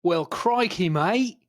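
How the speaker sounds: hammed-up Aussie greeting in an Australian accent; playful Australian accent to open the show. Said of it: "I've gone a completely different accent".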